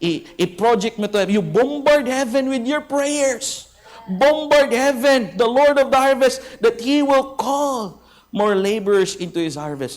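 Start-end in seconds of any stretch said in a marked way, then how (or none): 1.3-7.4 forceful preaching. Real, not acted. Pastor urges the congregation to storm heaven, shouting. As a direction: drive the words home like a battle cry.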